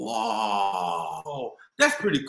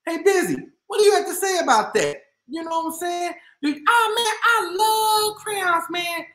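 high, louder puppet voice; Busy's voice; 8 semitones higher, 4 dB louder than usual. Acted. Reader's toy Busy gushes about crayons.

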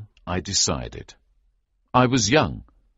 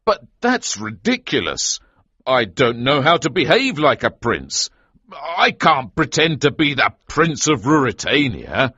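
higher, louder, alarmed objection; incredulous protest; 7 semitones higher, 6 dB louder than usual. A narrator's reading. Hero refusing to pretend to be the prince, incredulous protest. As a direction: protest it with rising force.

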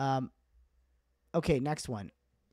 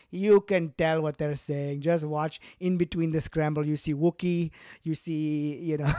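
louder, slow dismissive dad; dad's voice; 3 semitones higher, 6 dB louder than usual. Retold or imitated. Dad waving off scrambled tape, mock insistent. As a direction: brush off the kid's complaint like a stubborn dad. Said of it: "I'm like".

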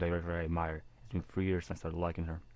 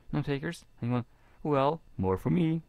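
mock pout; 7 semitones higher, 4 dB louder than usual. Pouting after no takers.

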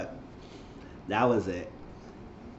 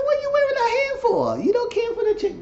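high squeaky put-on voice, louder; mock incredulous; 4 dB louder than usual. Talking to Suki about the wig, in a high mocking squeal. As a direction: ask it in a squealing, scandalized falsetto.

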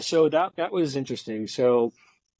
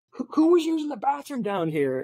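high-pitched imitated irritation; irritated janitor; 13 semitones higher than usual. Janitor demanding who used the bathroom, irritated and high. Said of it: "he's irritated".

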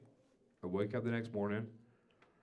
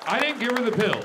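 much higher, loud shouted confession; punchline shout; 12 semitones higher, 11 dB louder than usual. Acted. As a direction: yell the twist to the crowd. Repeated confession line, higher.

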